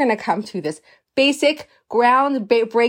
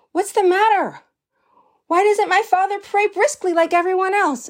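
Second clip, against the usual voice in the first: put-on higher-pitched voice of a questioning boy; puzzled child; 9 semitones higher than usual. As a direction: ask like a troubled little boy. Young boy questions his uncle.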